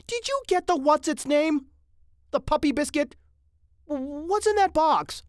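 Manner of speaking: high, loud interrogation; nagging wife. Acted. Mrs. Mitty questioning her husband on his errand, nagging. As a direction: fire questions in an exasperated voice.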